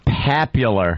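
"Popular" said low as "PAP-yuler"; mocking the announcer. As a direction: echo the announcer's "Popular" with a flattened first vowel, like "pap". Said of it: "I hate the way the guy goes".

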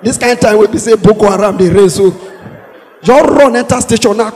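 normal talking voice

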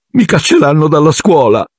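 put-on high, tearful girl's voice; a girl sobbing in despair. A narrator's reading. Ginny sobs to Harry about being expelled, high and tearful. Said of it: "singhiozzava Ginny".

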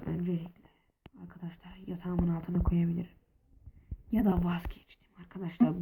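secretive whisper planning a hiding spot. Real, not acted. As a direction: whisper so nobody overhears. Hiding diamonds from Ahmet Usta. (fısıltıyla)

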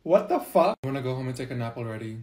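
usual speaking voice

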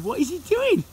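high, incredulous question; amused bafflement. Real, not acted. Grandson spotting grandad up the road, high and baffled. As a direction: pitch it right up in disbelief.

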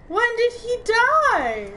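real distress, dragging each word out; stunned dismay. Real, not acted. NPC captain just died.

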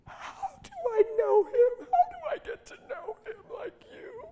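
very high, crying voice, acted out; slow, sobbing imitation of a woman pleading; tearful plea. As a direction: beg through mock tears, stretched out. Acts the weeping woman's plea.

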